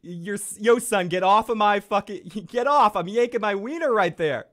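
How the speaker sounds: high, loud hammed-up yell; mock Night King. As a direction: shout it like a rude bully. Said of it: "Night King is like".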